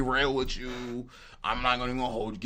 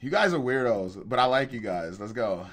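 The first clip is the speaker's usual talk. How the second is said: ordinary talk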